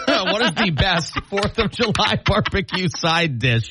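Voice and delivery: giggling through the question; trying not to crack up; laughing. Real, not acted. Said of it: "tried to say best and fourth".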